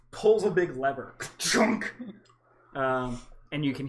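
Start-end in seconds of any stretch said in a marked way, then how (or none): 1.4-1.9 mouth sound effect. Acted. GM imitates lever sound.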